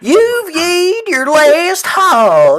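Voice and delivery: high, loud, slow hammed-up cowboy; hammed-up cowboy challenge to a rival. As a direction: drag out a mock Western showdown line. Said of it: "cowboy for a second".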